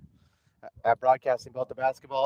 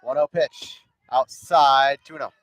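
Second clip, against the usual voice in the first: play-by-play; 3 semitones higher, 6 dB louder than usual. Acted. Live softball play-by-play.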